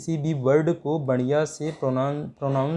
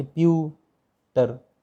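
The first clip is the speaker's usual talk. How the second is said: "Pewter" slowly split into "प्यू" and "टर"; syllable by syllable; much slower than usual. Shown as a demonstration. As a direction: say "pewter" in two slow, separate syllables. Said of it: "computer में तीन syllable है". ("Pewter" को धीरे-धीरे, अलग-अलग अक्षरों में)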